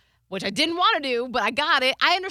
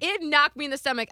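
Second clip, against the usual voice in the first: usual speaking voice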